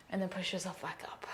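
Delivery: whispered push-up cue; a yoga teacher whispering encouragement. Real, not acted. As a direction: keep it to a breathy whisper. Cue during the push-ups.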